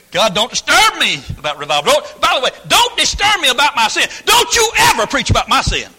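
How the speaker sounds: furious hammed-up demand; defiant mock prayer. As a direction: yell at God to leave you alone. Voicing a defiant churchgoer.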